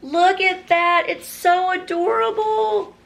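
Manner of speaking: squealing delight; gushing. Real, not acted. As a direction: coo over something adorable. Showing off a chicken apron.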